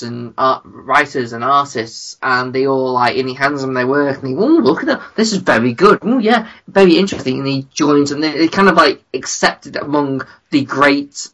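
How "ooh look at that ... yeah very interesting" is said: high, swooping mock admiration; impressed onlookers. Great artists admire a newcomer's work, mock-impressed.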